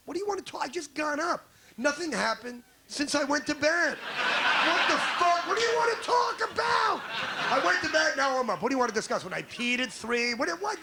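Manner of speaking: high, rising to a mock shout at husband's exasperation; hammed-up grumpy husband snapping back; incredulous husband yelling at wife over coffee; exasperated retort.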